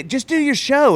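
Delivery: high-pitched, mock-annoyed order; exasperated manager. Manager tells comic to drop the callbacks, mock exasperation. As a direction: lightly imitate a fed-up manager. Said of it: "he would get so mad at me".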